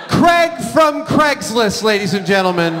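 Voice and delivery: big showman's introduction, stretched out; mock grand announcement. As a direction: present a star to a cheering crowd. Announcing a celebrity name to audience.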